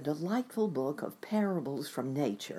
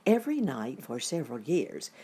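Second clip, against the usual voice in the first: plain reading voice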